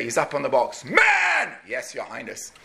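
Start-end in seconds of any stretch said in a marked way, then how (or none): 0.8-1.6 high, loud bark to the ranks; commander's call. Retold or imitated. King on the box calling his soldiers, loud. 1.7-2.5 lower, much softer, dispirited; barely answer, beaten; defeated mumble. Retold or imitated. Frightened soldiers answering the king, soft and low.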